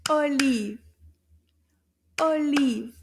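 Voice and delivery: Olive loud, in two punched beats; syllable by syllable. Shown as a demonstration. Teacher clapping syllables with children, Olive in two loud beats. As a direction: clap out both syllables of Olive. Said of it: "clap the syllables in this word".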